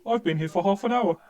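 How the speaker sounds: exaggerated English gent; Englishman voice. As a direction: play a put-upon Englishman. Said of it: "just my Englishman voice".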